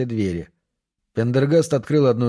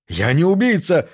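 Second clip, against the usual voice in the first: higher-pitched outcry of protest; indignant cry; 6 semitones higher, 3 dB louder than usual. A narrator's reading. Accused man protests to the police captain, crying out. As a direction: protest loudly, a touch short of a full shout. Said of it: "закричал он".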